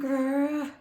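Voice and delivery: short mock growl; light frustrated grr; playful growl. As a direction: growl like a grumpy kid. Child frustrated.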